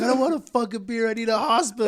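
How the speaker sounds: high, loud, pleading in pain; exasperated whine. Retold or imitated. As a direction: whine it like you're hurt and fed up. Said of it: "And I was like".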